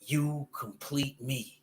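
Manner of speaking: soft, slow, tender movie-line delivery; Jerry Maguire line. Retold or imitated. The Joker telling Batman he completes him, softly and slowly. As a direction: hush it like a swooning romantic lead. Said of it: "Jerry Maguire, Tom Cruise".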